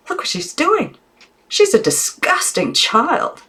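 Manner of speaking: very high, slow disgusted aunt; appalled aunt. A narrator's reading. Aunt appalled at Maggie's manners, very high. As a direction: recoil in prim disgust.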